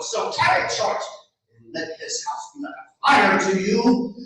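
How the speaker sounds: a mother fed up with her son; scolding mother. Acted.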